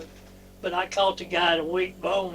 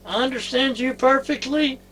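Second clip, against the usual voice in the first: higher, deliberate quote of a local fixer; quoting the dock fixer; 7 semitones higher, 4 dB louder than usual. Retold or imitated. Fixer to speaker's wife about understanding, raised quoted voice. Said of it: "he said".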